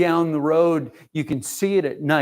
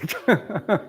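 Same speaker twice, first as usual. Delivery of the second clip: a short laugh through tears; embarrassed laugh while close to crying; tearful laugh; 6 semitones higher than usual. Real, not acted. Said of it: "she's making me cry".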